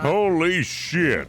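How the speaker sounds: high, loud and very slow; stunned awe. Acted. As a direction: drag it out, still reeling. After bear attack, stunned.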